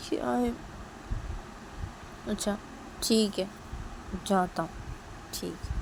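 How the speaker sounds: soft, subdued reply; quiet sulk. Acted. Brother quietly giving in and leaving the room. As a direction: mumble it softly, hurt.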